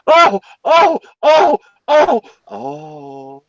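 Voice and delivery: high falsetto moans in rhythm; mock lady's moans. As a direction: imitate a woman crying out, trailing off. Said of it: "you hear the lady go".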